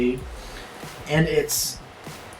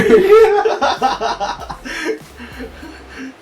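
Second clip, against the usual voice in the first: long high burst of laughter; 10 semitones higher than usual. Real, not acted. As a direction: crack up. Big laugh.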